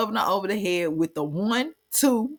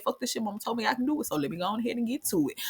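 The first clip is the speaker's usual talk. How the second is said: fast and much softer, muttering to himself; quick quiet mutter; 3 semitones higher, 8 dB quieter, much faster than usual. Retold or imitated. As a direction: rush it under your breath, psyching yourself up. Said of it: "but he said".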